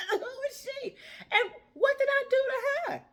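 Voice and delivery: high disbelieving laugh through the words. Real, not acted. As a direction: laugh it off in amazement. Laughing at a rude stranger.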